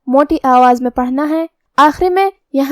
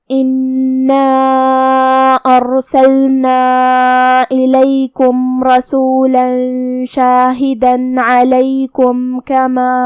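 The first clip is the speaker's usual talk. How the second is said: slow melodic tajweed recitation; Quran recitation; slower than usual. Shown as a demonstration. Teacher recites the verse for students, slow chant. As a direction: chant the verse slowly and evenly.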